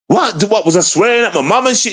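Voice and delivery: high-pitched incredulous question; shocked disbelief. Retold or imitated. Son learning he upset his mum, shocked disbelief. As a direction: react aghast, pitch jumping up.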